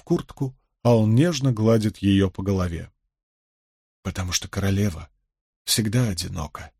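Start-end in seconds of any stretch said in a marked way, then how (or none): 4.0-6.7 low, soft and gentle; quiet reply. A narrator's reading. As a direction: lower the voice to console her. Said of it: "тихо ответил Том". (тихо, вполголоса)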